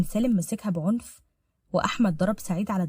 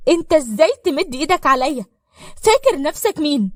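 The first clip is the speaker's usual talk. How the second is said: loud, higher-pitched indignant challenge; angry, tearful confrontation; 7 semitones higher, 8 dB louder than usual. Acted. Crying woman confronts Salem for laying hands on her, indignant and loud. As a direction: throw it at them in outrage. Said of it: "زقته بعنف وهي بتعيط".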